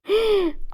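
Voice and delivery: sharp gasp of realisation; shocked gasp. A narrator's reading. Wife realising what is in juice, shocked gasp. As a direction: suck in breath in shock.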